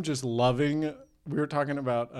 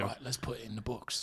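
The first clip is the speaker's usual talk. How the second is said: soft-spoken British impression; hushed British star; Jason Statham; 8 dB quieter than usual. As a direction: agree quietly, close to the mic. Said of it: "whisper it a little bit".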